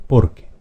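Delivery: "Porque" as POR-que, second syllable left light; stress on the first syllable. Shown as a demonstration. Teacher repeating the palabra grave, first-syllable stress on "Porque". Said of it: "es una palabra grave".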